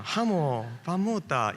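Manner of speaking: 상냥한 경상도 사투리; angelic Gyeongsang dialect. Retold or imitated. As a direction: answer kindly in Gyeongsang dialect. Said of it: "천사처럼 대화하는".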